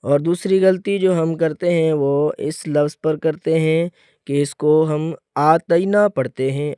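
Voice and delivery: ordinary delivery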